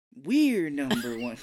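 loud, slow swoop up on "We're"; cheer, stress on WE'RE. Retold or imitated. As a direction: punch and stretch "We're" in a mock cheer. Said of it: "how many times can I change the inflection".

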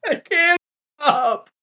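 high, breaking laughing wail; helpless laughter. Real, not acted. Player begs to stop the accent, helpless laughter.

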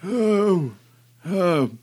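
put-on high, slow, shaky old man voice; quavering elderly man, drawn out. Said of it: "Yes, the old man voice".

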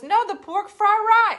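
put-on Grandma's loud, high, slow accented insistence; hammed-up accented correction; imitated restaurant accent. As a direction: stretch the parroted accent, dropping the end of "rye". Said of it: "That's what, how Miss Eileen said".

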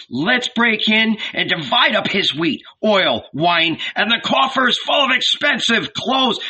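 high, rousing mob voice; eager urging. A narrator's reading. Ragamuffins plan to loot Zebedee's house, eager.

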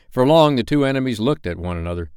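plain reading voice

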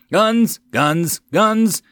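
high, loud cries of triumph; excited shout. A narrator's reading. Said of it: "he cried out in excitement".

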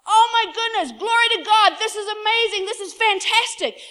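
high, loud, hammed-up wonder; overjoyed amazement. Prophet opens the door and sees God's army, ecstatic. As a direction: exclaim each phrase in big delight.

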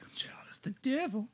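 high eerie whisper; spooky whisper. Pretend. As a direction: whisper it like a ghost. Spooky echo of the story.

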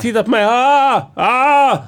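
high hammed-up wails for attention; escalating high-pitched mock cries; mock screaming. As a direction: play a drugged man screaming to be noticed. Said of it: "Jag vet exakt vad det är för skrik".

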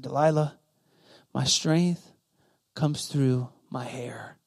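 low, soft, halting admission; quiet confession. Acted. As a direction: give up the secret slowly and softly. Samson gives in and confesses.

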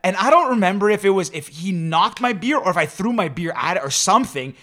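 ordinary talk

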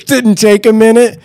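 high, laughing teasing; teasing through laughter. Real, not acted. Host laughing at her.

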